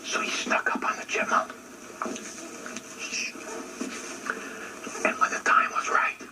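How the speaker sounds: hushed narration, breath only; sneaky whisper. A narrator's reading. Narrator creeping up on the chipmunk, whispered.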